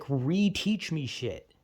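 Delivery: usual speaking voice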